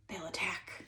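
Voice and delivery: trailing into an unvoiced whisper; hushed warning whisper. A narrator's reading. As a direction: drop to a scared whisper at the end. Joe warns in fear.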